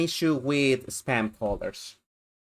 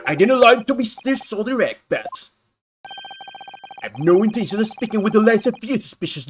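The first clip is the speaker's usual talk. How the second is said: pompous governor; 2 semitones higher, 8 dB louder, faster than usual. Acted. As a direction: puff up as the proud governor.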